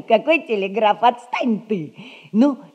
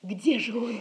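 usual speaking voice

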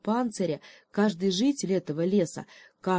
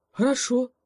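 quick agreement; 6 semitones higher, 4 dB louder than usual. Acted. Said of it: "тут же согласился".

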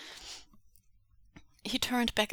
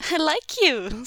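loud delighted laugh in voice; amused captor praising prisoner; 9 semitones higher, 8 dB louder than usual. Acted. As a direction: exclaim with glee.

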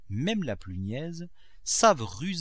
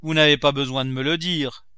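loud, higher comeback; exclaimed retort; 4 semitones higher, 10 dB louder than usual. A narrator's reading. Cook retorting to her master's needless order, loud exclamation. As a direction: throw it back at the master. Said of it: "s'écria Nanon".

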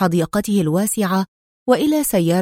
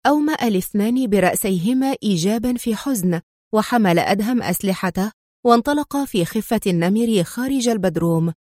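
usual speaking voice

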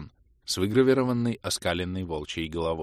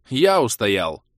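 gruff, loud retort; grumbled; 4 semitones higher, 8 dB louder, much slower than usual. A narrator's reading. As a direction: snap it back grumpily. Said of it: "буркнул я".

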